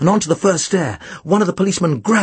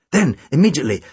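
ordinary delivery, nothing marked

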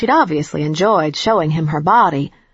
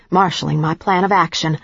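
plain reading voice

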